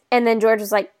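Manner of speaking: normal talking voice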